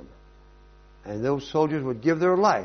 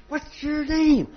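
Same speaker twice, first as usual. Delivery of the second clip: high, slow, pressing demand; enraged; 4 dB louder, slower than usual. Acted. Alexander grabbing the soldier, a high slow furious question. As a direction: drag each word out in fury. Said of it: "went into a rage".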